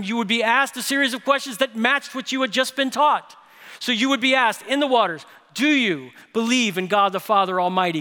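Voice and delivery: loud, high, driving sermon delivery; full-force preaching. Real, not acted. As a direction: build to a ringing call from the pulpit.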